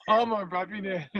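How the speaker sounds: laughing, "All my Bravi there" in Liberian Kolokwa; a laughing demo of the Liberian Kolokwa line; Liberian Kolokwa accent. Shown as a demonstration. Host laughing while modelling the Liberian Kolokwa greeting. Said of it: "this is that accent".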